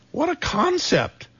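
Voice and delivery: high, slow mock-astonished aside; wry amazement. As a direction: marvel at it with tongue in cheek. Wry praise of home cooking.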